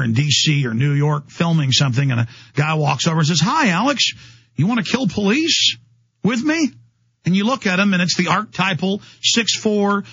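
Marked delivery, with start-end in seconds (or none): 3.4-6.7 higher, sing-song fake friendly greeting; mock friendly cop. Undercover cop baiting the host at an event, mock friendly. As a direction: ham up a sly undercover agent's invite.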